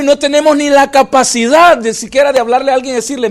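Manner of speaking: loud impassioned sermon voice; full-force pulpit preaching; fervent preaching. Acted. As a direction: preach it, pushing to the congregation.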